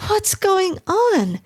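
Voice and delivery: startled wife, roused from sleep; startled wife exclaiming. A narrator's reading. Wife exclaiming at husband pounding on door. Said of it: "she exclaimed".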